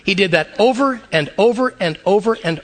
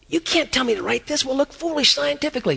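higher, softer worried Isaiah objecting; a prophet balking at his orders, higher and hushed; Isaiah protesting; 6 semitones higher, 4 dB quieter than usual. Retold or imitated. Isaiah protesting to God, higher and softer. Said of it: "I can see Isaiah going".

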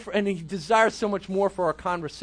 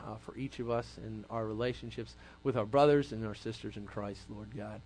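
soft, low prayer voice; closing prayer; 9 semitones lower, 8 dB quieter than usual. Real, not acted. Pastor closes the talk praying for the youth group, soft and hushed. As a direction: pray quietly over the group.

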